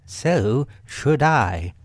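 drawn-out storybook animal agreement; Rabbit. A narrator's reading. Rabbit agreeing with Pooh, slow emphatic voice.

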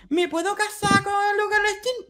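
squeaky lovestruck fan impression; mock starstruck fan. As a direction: mimic a giddy fan asking. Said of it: "una solamente dijo".

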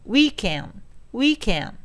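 "weekend" with a strong first syllable, falling away; stress on WEE. Shown as a demonstration. Teacher shows where stress falls, strong first syllable of "weekend". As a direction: punch the "wee" in "weekend", weaken the end. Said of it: "最初のEの部分が強いわけですね".

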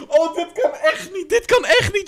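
high voice breaking with laughter; helpless, high-pitched laughing words; laughing disbelief. Real, not acted. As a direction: protest through real laughter. Cracks up at the clip.

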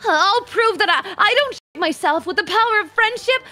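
earnest determined anime hero. Shown as a demonstration. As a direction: declare it like a shonen lead. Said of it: "like an anime protagonist".

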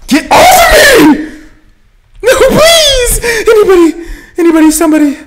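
high shrieking screams for help; panicked screaming, half laughing; being chased. Real, not acted.

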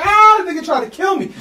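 high, loud panicked cry; freaked-out scream at the dog attack; panicked yell. Retold or imitated. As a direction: yell it like you think you're dying. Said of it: "I'm like".

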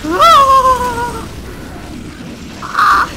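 long high scream, then another; loud, high shriek of alarm; panicked scream. Real, not acted. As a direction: scream at full volume under attack. Screaming under attack in game.

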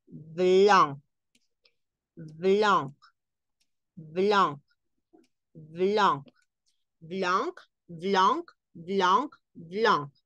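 blanc said blon, nasal vowel, no c; French blanc. Shown as a demonstration. Teacher models blanc in French. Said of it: "vamos con blanco".

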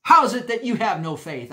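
loud, high, stern rebuke; Jesus rebuking his frightened disciples, loud and stern. Retold or imitated. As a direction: scold the disciples sharply. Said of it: "I'm convinced he said it just like that".

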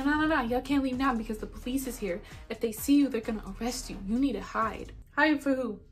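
usual speaking voice

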